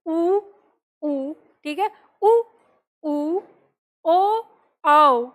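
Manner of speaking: उ, ऊ, ओ, औ said with rounded lips; showing the class rounded vowels. Shown as a demonstration. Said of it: "लेकिन जब हम बोलते हैं".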